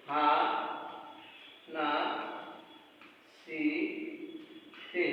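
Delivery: হা, না, সি, তে said as four slow separate syllables with long pauses. Shown as a demonstration. Teacher to students breaking down a Japanese word, syllable by syllable. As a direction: break হা, না, সি, তে into single syllables, pausing between each. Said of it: "ভেঙে ভেঙে আপনারা উচ্চারণ করবেন".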